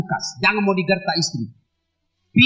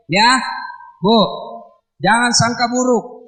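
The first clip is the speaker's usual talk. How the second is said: ordinary talk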